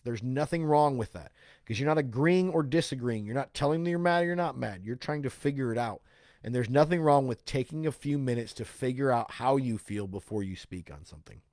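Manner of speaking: usual speaking voice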